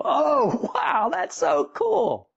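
high, slow, wide-eyed wow; mock amazement. Seeing the swan in the clouds, high slow delighted gush. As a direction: gush like you finally see the swan.

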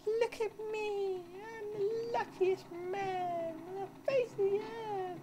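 high, slow put-on boasting voice; mock proud hero. Player voicing his tank as an overjoyed boaster. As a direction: crow like a ridiculous overjoyed hero.

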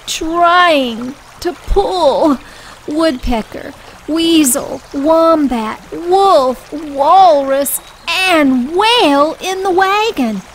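narrated with mock effort; straining. Straining to pull heavy load.

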